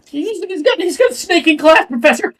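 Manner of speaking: high, shrill-pitched urgent tattling; tattling alarm. Acted. Classmate alerting the professor, high urgent tattling. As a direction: call out to the teacher in alarm.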